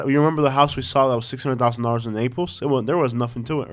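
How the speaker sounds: ordinary talk, nothing marked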